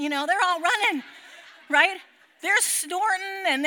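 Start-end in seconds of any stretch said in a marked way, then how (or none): none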